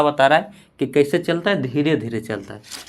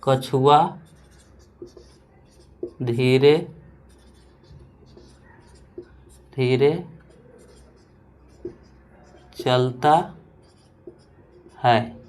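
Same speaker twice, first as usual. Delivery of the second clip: word by word with long pauses; slow dictation; much slower than usual. Shown as a demonstration. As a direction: dictate slowly for notes. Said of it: "देखिए". (धीरे-धीरे बोलकर लिखवाना)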